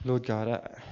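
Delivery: hushed, unhurried prayer with long pauses; reverent, spacious address to God. Real, not acted. Leads a closing prayer.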